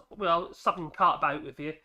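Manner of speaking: normal talking voice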